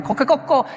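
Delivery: a high imitated coquecoco rooster crow; Japanese rooster crow. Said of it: "cacarea en japonés".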